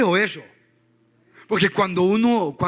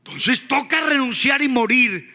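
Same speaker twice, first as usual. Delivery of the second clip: fervent preaching; 7 semitones higher than usual. Acted. Preacher urging the church to surrender, fervent preaching. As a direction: press the call to surrender.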